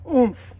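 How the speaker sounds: bump into the snow with a loud oomph; playful thud of a landing; comic oomph. A narrator's reading. Tumbles into snow.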